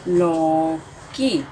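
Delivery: लौकी drawn out slowly; slow sounding out. Shown as a demonstration. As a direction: stretch लौकी for the class. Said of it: "बड़े ओ की मात्रा, क, बड़ी ई की मात्रा".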